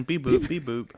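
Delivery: chirpy robotic beeps; beep boop noise. Pretend. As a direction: imitate a news alert jingle. Imitates news jingle beeps.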